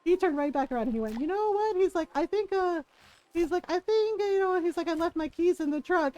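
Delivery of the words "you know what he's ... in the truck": much higher, nervous excuse to escape; flustered stepdad. Retold or imitated. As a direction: squeak out a hasty excuse and bolt. Stepdad flees the sisters' fight.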